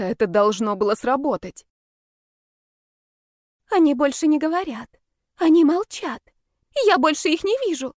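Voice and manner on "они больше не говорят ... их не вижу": high-pitched, urgent report; high, agitated character voice; agitated babbling. A narrator's reading. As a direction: blurt it out in a high voice. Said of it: "протараторила Джой".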